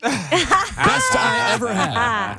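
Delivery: high, slow, drawn-out anchor rap; old-timey news anchor. Acted. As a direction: croon it like an old newsreel announcer. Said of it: "rapping in old-timey news anchor voice".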